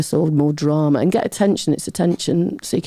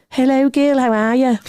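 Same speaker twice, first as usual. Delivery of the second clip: Georgie's catchphrase; 8 semitones higher than usual. Retold or imitated. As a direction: imitate a friend's pet greeting in a high voice. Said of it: "Georgie might have a little saying like".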